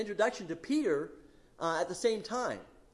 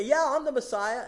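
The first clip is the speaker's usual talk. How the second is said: mock boastful claim; 6 semitones higher, 6 dB louder than usual. False messiahs boasting.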